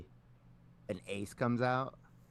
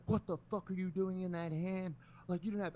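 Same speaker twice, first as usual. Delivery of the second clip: angry imitation; 10 semitones higher than usual. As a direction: imitate a sore loser losing it. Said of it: "fucking angry".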